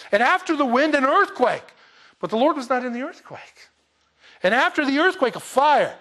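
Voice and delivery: loud, high, emphatic Bible reading; loud scripture reading. Acted. Preacher reading Elijah's complaint aloud to the church, emphatic and loud. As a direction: proclaim the verses to the congregation. Reads the earthquake passage.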